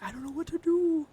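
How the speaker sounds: hammed-up high, loud cry of helplessness; high-pitched, exaggerated mock panic; mock helpless wail. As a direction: wail it loudly, playing at being lost. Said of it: "I'm like".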